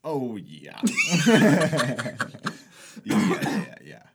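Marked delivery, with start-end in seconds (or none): 0.8-3.0 very loud, high, long laugh; big burst of laughter. Real, not acted. Friend cracking up at a shared memory, booming laugh. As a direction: crack up loudly.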